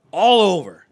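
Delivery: high, loud exclaim; loud shout. Retold or imitated. Retelling the vomiting.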